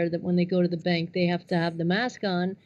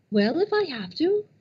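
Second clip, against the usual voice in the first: higher mock resigned mother; 3 semitones higher, 4 dB louder than usual. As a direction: play the mother giving in, higher. Mother quoted, yelling scene.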